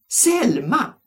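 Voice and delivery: high loud outburst; exclaiming. A narrator's reading. Mimmi blurting out to the class, surprised. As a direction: blurt the name out.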